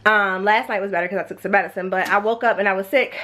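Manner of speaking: normal talking voice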